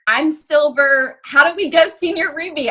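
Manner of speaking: retelling her own impatient eagerness; eager excited quote. Retold or imitated. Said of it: "So I called my sister and was like".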